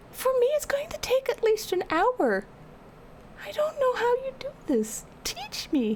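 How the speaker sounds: high, whiny mock crying; hammed-up despairing whine. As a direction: whine it like you're about to cry. Envies fast sleeper, mock despair.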